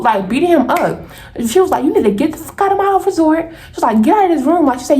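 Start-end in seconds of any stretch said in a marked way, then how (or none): 1.8-3.5 high-pitched retold telling-off; angry eviction. Friend throwing him out, acted. 4.0-4.7 high-pitched retold telling-off; angry eviction. Friend throwing him out, acted.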